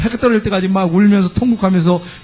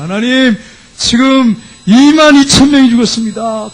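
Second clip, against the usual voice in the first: higher, drawn-out mock wail; imitated weeping lament; 4 semitones higher, 4 dB louder, slower than usual. Defeated Israelites crying to God over their dead, imitated wailing. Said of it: "그러면서 막 우는 거예요".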